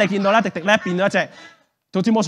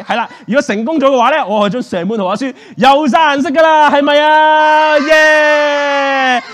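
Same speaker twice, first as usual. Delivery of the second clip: triumphant showman announcement; 7 semitones higher, 6 dB louder than usual. Acted. Magician tells children the book is coloured, triumphant. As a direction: whip the crowd into a cheer.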